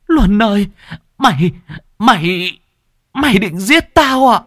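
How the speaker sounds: high, loud, shrieking panic; terrified scream. Acted. Bleeding mother screams at her son in terror.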